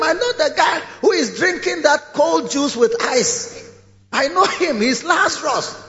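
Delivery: high, urgent begging voice; pleading rich man. Acted. Rich man begging Abraham to send Lazarus with water, high. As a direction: beg Abraham for water, eager.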